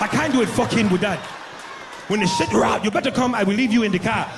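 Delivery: angry guide who won't touch the lion; African guide. Pretend. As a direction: African accent, furious and loud.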